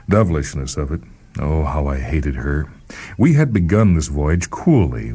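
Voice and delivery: plain reading voice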